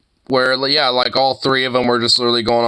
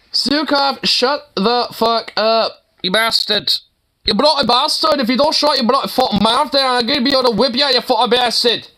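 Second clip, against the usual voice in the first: put-on high Irish accent tirade at a chatter; mock Irish accent; 8 semitones higher than usual. Streamer telling off chatter Zukov, high mock Irish accent. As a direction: berate him like a heated Irish TD. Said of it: "sterile Irish accent".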